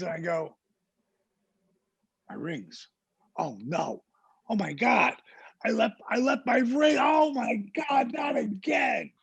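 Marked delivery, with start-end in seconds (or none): 2.3-4.0 low, hushed acted dread; soft, sinking voice of sudden loss; stunned realization. Realizes rings are lost. 4.5-9.1 high, strained acted outcry; panicked yelling. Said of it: "yelling in the bathroom".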